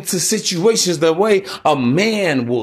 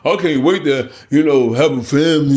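sarcastic; 3 semitones lower than usual. Pretend. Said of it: "understand my voice".

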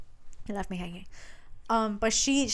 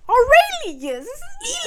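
very high, loud mock proclamation; grand reveal; 9 dB louder, slower than usual. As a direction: belt out the name like a dramatic reveal. Acting out the grand name reveal.

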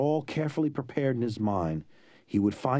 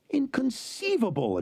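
high, slow indignant outburst; exploding in outrage. A narrator's reading. Sicilian exploding at the bad news, high and slow. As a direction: explode with disbelief. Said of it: "exploded the Sicilian".

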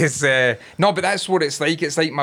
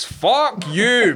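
mock outburst; 4 semitones higher than usual. Acted ice-cream van exchange with guns.

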